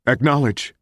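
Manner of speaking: easy, offhand acknowledgment; understated chatty radio answer; conversational. A narrator's reading. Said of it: "Richard said conversationally".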